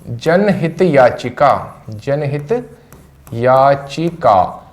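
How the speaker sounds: जनहित याचिका drawn out word by word; slow dictation. Shown as a demonstration. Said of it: "इसको हिंदी में क्या बोलते हैं". (धीरे-धीरे बोलकर लिखवाना)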